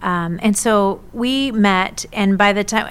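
normal talking voice

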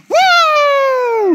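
high, loud, sustained whoop; Ric Flair woo. Shown as a demonstration. Preacher leads the congregation in a long high woo. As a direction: whoop big and lead the crowd. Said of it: "If you wanna do it with me".

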